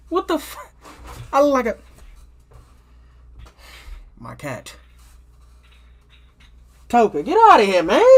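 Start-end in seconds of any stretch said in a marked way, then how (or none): 0.1-1.8 sudden high what the; blurting it out, caught off guard; startled outburst. Real, not acted. Breaking off the story, startled by the cat. 6.9-8.0 loud, high call to shoo the cat. Real, not acted. Telling his cat Toka to get out, loud and annoyed.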